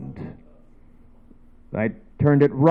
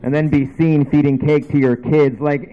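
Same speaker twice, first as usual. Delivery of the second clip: ordinary talk, nothing marked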